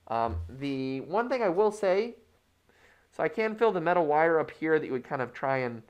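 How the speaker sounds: muffled behind cloth mask; speaking under fabric covering; through a face mask. Real, not acted.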